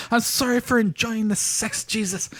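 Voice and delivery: slow, high badly done Irish accent; mock Irish confession. Host mocks a guilty Irish lover, slow Irish accent. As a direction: draw it out in a hammed-up Irish lilt. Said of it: "that's more Southern Irish".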